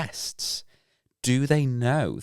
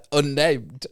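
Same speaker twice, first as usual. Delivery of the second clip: laughing; 7 semitones higher, 7 dB louder than usual. Real, not acted. Laughing at own stumble.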